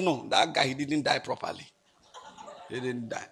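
low, soft quoted explanation; doctor's hushed verdict. Retold or imitated. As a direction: drop low and quiet, trailing almost to nothing.

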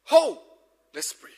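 ordinary talk, nothing marked

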